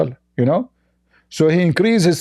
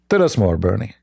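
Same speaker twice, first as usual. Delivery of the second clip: mocking; 9 semitones lower than usual. Real, not acted. Mocking Bernie Sanders.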